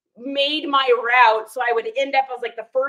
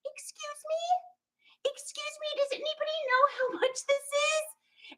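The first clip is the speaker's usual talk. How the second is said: much higher, softer, slow and meek; much higher, softer, slow tiny voice; timid high voice; 14 semitones higher, 9 dB quieter, slower than usual. Retold or imitated. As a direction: squeak it out like a lost mouse. Timidly asking who sells.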